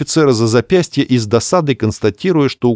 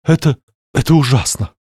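faltering dismay; 5 semitones higher than usual. Acted. Clarissa in despair.

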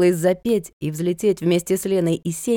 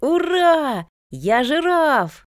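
high, drawn-out triumphant cry; joyful shout; 11 semitones higher, 4 dB louder, much slower than usual. A narrator's reading. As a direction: crow like an elated small boy. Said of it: "закричал тот".

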